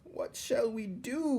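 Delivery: slow, very high wail. A narrator's reading. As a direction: wail helplessly, stretched out. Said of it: "wailed Cook".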